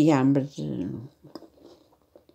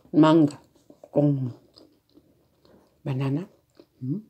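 talking with food in mouth; mouth full; slower than usual. Real, not acted. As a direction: speak through a mouthful. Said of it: "a falar com a boca cheia".

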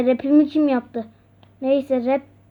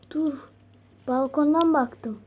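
normal talking voice

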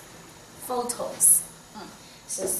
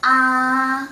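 Ah long, loud, high and unbending; high level first tone; 4 semitones higher, 7 dB louder than usual. Shown as a demonstration. Teacher reviewing from first tone, high level Ah. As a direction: hold Ah steady on a high pitch. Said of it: "From first tone to last tone".

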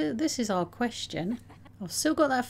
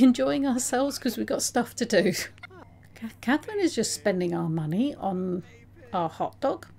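ordinary delivery

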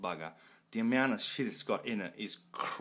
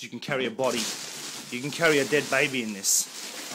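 loud joking exaggeration; 3 semitones higher, 6 dB louder than usual. Real, not acted. Joking about huge box.

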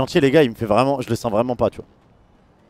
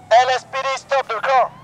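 police command; 9 semitones higher, slower than usual. Acted. Officer ordering a car to stop.

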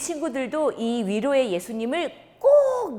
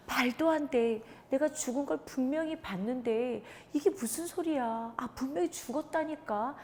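doubting murmur among themselves; 4 semitones higher, 6 dB quieter than usual. Acted. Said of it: "자기들끼리 소곤소곤".

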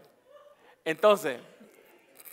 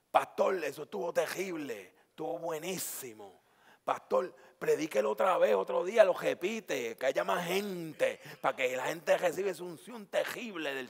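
put-on Puerto Rican accent. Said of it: "En puertorriqueño".

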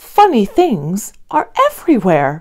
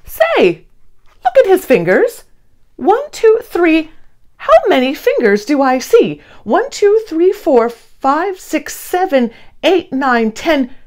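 high, bright counting voice; child-like wonder while counting; excited counting; 8 semitones higher than usual. A narrator's reading. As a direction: count in amazement. Excited counting of a creature's fingers.

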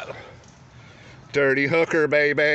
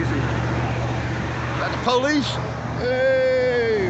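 high, slow hail to passing cops; calling out; 9 semitones higher, much slower than usual. Real, not acted. Man hailing police from his driveway, calling out. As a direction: shout across the street to them.

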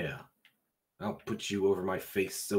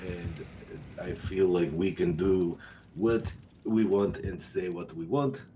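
slow, hammed-up Russian accent. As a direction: declaim like a mock-patriotic Russian. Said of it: "on the Russian accent".

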